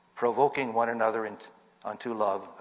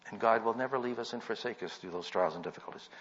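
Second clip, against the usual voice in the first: ordinary talk, nothing marked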